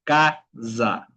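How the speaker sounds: "Casa" split in two, ca-za; buzzing S. Shown as a demonstration. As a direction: buzz the S of "Casa" like a bee. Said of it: "Vamos a hacer ese sonido". ("Casa" en sílabas, con S sonora)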